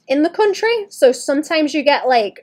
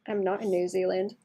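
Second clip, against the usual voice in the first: normal talking voice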